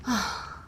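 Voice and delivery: short, exasperated vampire sigh; weary sigh. Acted. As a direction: let out a world-weary sigh. Weary vampire sighs at the intrusion.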